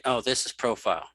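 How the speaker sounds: usual speaking voice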